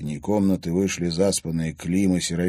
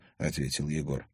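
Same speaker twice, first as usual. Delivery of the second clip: usual speaking voice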